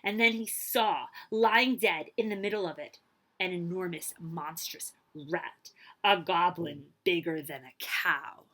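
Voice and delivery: usual speaking voice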